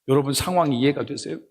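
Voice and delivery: ordinary delivery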